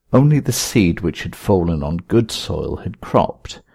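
ordinary delivery

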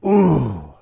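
put-on wheezing old man groan; aged croak. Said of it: "old man voice for too long".

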